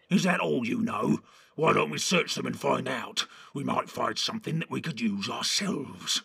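lightly voiced pushy orc; challenging orc. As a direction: egg the others on as an orc.

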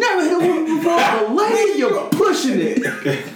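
high, laughing, squeaky movie-star voice; Chris Tucker impression. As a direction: belt it out high through laughter. Quoting Chris Tucker movie line.

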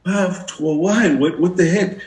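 high, startled what-the-heck; baffled surprise. Retold or imitated. Flooded with prophecies, baffled.

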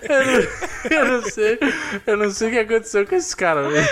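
high, laughing through the words; can barely talk for laughing. Real, not acted.

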